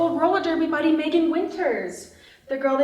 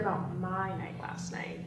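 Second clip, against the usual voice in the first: whisper; 6 semitones lower, 10 dB quieter than usual. Acted. Gossiping about wild night.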